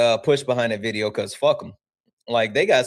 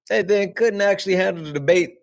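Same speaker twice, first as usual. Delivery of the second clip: ordinary delivery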